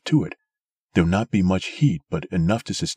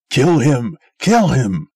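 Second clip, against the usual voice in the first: loud, higher frenzied KILL him; raving; 8 semitones higher, 9 dB louder, slower than usual. A narrator's reading. Old man raving at the crowd to kill Sim, very loud. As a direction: rave for his death. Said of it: "raved the old man".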